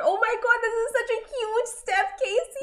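high, gushing excitement; high-pitched thrilled cheer; excited. Real, not acted. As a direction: squeal it out for her friend. Excited congratulations on driving test.

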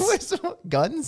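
high, slow mimicry of a Southern drawl; mock the Southern accent back, laughing; plantation Southern accent. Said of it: "from, like, the plantation?".

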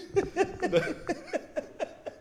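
squealing high laugh. Real, not acted. Laughing at the impression.

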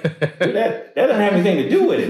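high, laughing disbelief at the doctor; telling the doctor off through laughter; laughing retort. Retold or imitated. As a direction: laugh it off while setting him straight. Retold comeback to the doctor.

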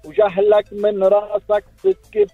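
zajal recitation. Acted. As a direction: declaim it forcefully. Rival poet's verse. (إلقاء زجل بقوة)

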